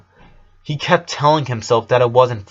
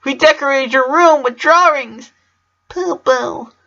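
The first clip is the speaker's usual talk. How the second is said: put-on much higher childlike chirp; high little girl voice; 4 dB louder than usual. Small sisters show off drawings, high child voice. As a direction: sound like a small eager kid.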